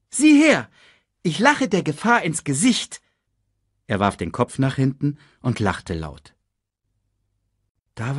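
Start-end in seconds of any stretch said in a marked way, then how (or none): 0.1-3.0 high-pitched show-off; boastful. A narrator's reading. Simba showing off to Sasu, boastful. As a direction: brag with loud, youthful bravado. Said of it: "prahlte Simba".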